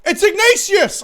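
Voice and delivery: excited shout. Acted.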